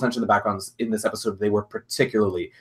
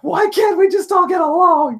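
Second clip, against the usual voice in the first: much higher, louder, slow pleading cry; pleading Fluttershy; 6 dB louder, slower than usual. Retold or imitated. Fluttershy begging everyone to get along, high slow wail. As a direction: wail it out like a desperate peacemaker. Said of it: "Fluttershy for just being like".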